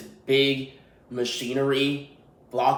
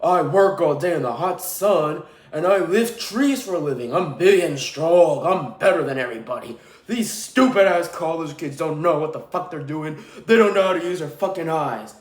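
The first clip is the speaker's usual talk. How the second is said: mock macho meathead. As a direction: ham up a dumb strongman bragging. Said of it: "and be like".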